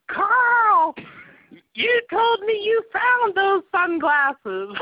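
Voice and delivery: very high, loud, slow Ukrainian mom; screaming Ukrainian accent. Retold or imitated. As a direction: shriek it in a thick Ukrainian accent. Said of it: "thick Ukrainian accent, like, screaming".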